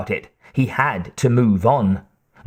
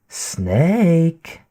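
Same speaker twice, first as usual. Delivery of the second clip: purring; 5 semitones higher than usual. A narrator's reading. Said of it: "the woman purred".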